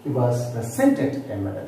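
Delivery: "presented" with SENT punched; stress on second syllable. Shown as a demonstration. Teacher repeating the verb example, second-syllable stress on "presented". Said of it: "I stressed on the second syllable".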